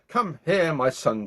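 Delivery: ordinary delivery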